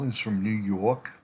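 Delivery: lightly put-on New York accent. Vlogger reading a real estate agent's profile, mock New York accent. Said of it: "my New York accent".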